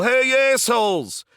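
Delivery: put-on high, loud jeering shout at the older kids; taunting yell. Kids yelling insults at skateboarders to get chased, loud taunting shout. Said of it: "we would yell".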